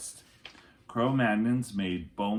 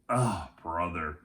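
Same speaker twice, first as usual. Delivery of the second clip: sighing exasperation; 3 semitones lower than usual. Acted.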